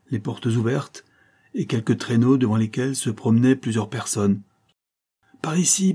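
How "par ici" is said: high calling voice with a rise on ici; a crowd voice calling from afar. A narrator's reading. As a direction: call them over, pitched up high. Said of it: "s'écrièrent quelques voix".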